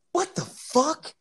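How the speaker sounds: slow, high-pitched retold disbelief; incredulous. Reacting to the thief's confession in a story, incredulous. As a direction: stretch it out in high, astonished protest.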